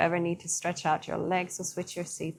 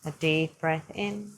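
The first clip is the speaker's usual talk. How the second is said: calm yoga teacher easing the class into stillness; guided meditation. Acted. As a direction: lead them gently through the breath. Guides a seated breathing practice.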